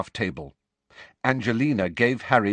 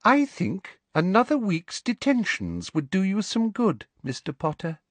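higher, silky threat; sweetly menacing, unhurried; sugary teacher handing out detention; sleek Umbridge; 11 semitones higher than usual. A narrator's reading. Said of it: "said Umbridge sleekly".

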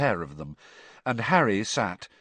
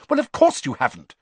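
brisk; 4 semitones higher, 5 dB louder, faster than usual. Acted. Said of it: "said Hermione briskly".

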